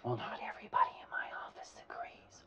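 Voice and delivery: fast secretive whisper. Retold or imitated.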